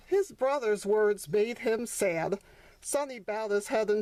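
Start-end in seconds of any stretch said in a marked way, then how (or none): none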